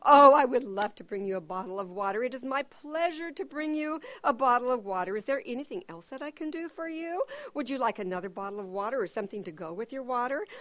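joyful servant thrilled to fetch water; great joy. Acted. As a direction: gush with delight to serve. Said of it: "The servant says with great joy".

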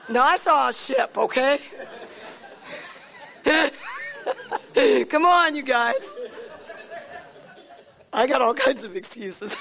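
words broken by real laughter; sheepish laughing apology; laughing at himself. Real, not acted. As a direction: giggle through the excuse. Preacher laughs at own slip.

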